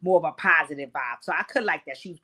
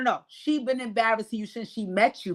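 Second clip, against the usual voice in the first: ordinary talk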